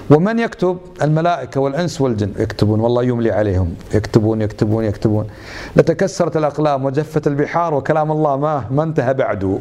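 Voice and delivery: usual speaking voice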